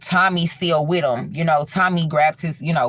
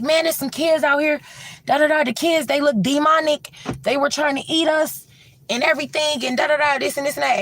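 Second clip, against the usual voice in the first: higher, hurried and spooked; scared friend explaining; 11 semitones higher, faster than usual. Retold or imitated. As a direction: tell it like you barely escaped. Scooter explaining the demonic kids.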